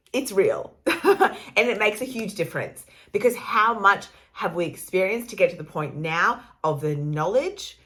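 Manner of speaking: usual speaking voice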